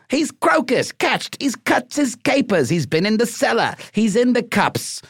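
high, exaggerated Cockney voice reeling off slang; Cockney accent. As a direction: rattle them off like a cheeky Cockney drunkard. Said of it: "if you say them in a Cockney accent".

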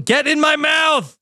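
high mock yell at a balloon; yelling at a balloon to get in. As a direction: shout the order up high. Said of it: "you yelled at the balloon".

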